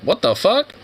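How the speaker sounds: high, excited disbelief; hyped up about blinged-out squirrels; amazed. Real, not acted. Streamer marvelling at squirrels in gold chains, high and excited. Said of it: "amazed at squirrels in gold chains".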